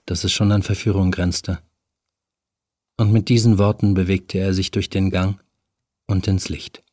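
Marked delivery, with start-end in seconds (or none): none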